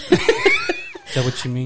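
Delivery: real laughter cracking a mock-therapist question; laughing. Real, not acted. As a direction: question breaking into a big laugh.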